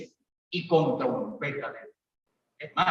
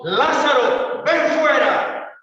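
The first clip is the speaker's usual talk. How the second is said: a great commanding call, higher and louder, "ven fuera" drawn out slowly; loud cry; 7 semitones higher, 5 dB louder, much slower than usual. Retold or imitated. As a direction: call the dead man out with full authority. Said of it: "clamó a gran voz".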